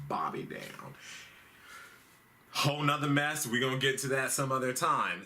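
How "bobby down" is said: breathy whisper of a punchline; whispered aside. Real, not acted. Comic whispering to Rosenstein to shut Bobby down. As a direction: drop to a sly whisper.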